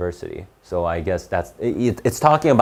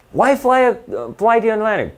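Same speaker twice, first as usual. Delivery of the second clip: mocking incredulity; 12 semitones higher than usual. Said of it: "he dismisses this as a ridiculous question".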